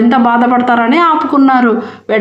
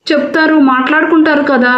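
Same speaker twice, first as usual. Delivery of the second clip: plain reading voice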